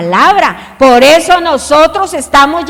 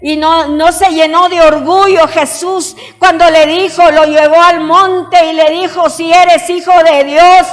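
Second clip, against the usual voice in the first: higher, louder preacher's cry; full-force preaching; 6 semitones higher, 5 dB louder, slower than usual. Acted. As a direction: preach it out, rising to the congregation.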